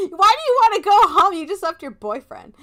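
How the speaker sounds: high, loud, amused disbelief; incredulous. Real, not acted. Reader questioning the heroine's wish, incredulous.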